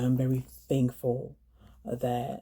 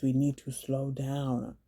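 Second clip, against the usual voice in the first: "we need to slow down" stretched out at half speed; drawn-out emphasis; much slower than usual. Real, not acted. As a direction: spell out "slow down" slowly for emphasis. Repeated plea, final time drawn out.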